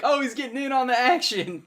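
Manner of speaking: high, loud mock-excited exclamation; mock excitement. Teasing retold quote of friends.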